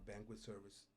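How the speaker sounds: ordinary talk, nothing marked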